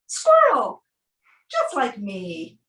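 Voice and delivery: usual speaking voice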